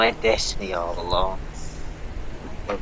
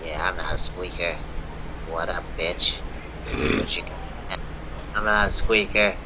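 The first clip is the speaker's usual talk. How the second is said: low, soft pretend tough guy; lowered voice; 6 semitones lower, 6 dB quieter than usual. Squeaker insisting he isn't one, in a lowered voice. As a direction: keep it down low, denying squeakiness. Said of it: "I lower my voice like this".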